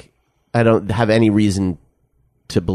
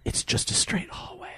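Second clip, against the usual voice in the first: whispered confession; mock-secret whisper; 15 dB quieter than usual. Co-host reveals the truth about the building, mock-secret whisper.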